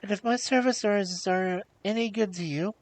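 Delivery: high, tentative offer; pleading princess. A narrator's reading. Princess offering her services, pleading.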